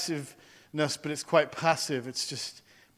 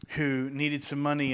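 ordinary talk

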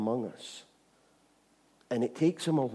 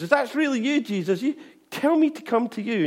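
higher, louder pleading Peter; eager Peter; 11 semitones higher, 6 dB louder than usual. Retold or imitated. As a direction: call out across the water. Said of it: "And Peter goes".

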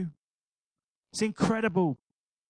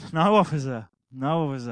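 mock innocent denial; 4 semitones lower, 5 dB louder than usual. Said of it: "I said it like that".

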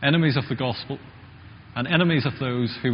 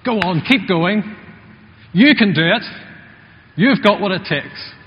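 louder, higher, slow cheer; cheering from the sidelines; 5 semitones higher, 6 dB louder than usual. Retold or imitated. Spectator cheering a runner at the London Marathon, loud encouragement. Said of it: "Well, you shout".

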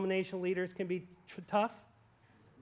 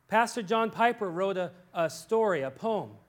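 ordinary talk, nothing marked

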